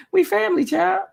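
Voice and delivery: high-pitched laugh through the words. Real, not acted. Host laughing to her viewers, high giggly voice.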